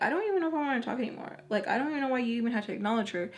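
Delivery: fast, tumbling angry words; rushed retort. Retold or imitated. Telling the boyfriend off, rushed and fed up. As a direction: rattle it off at him.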